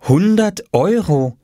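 slow, high-pitched astonishment; amazed reading aloud. A narrator's reading. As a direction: read the sign out slowly, marvelling. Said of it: "las Mama auf dem kleinen Papierschild, ... und staunte".

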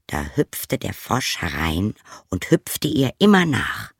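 ordinary delivery, nothing marked

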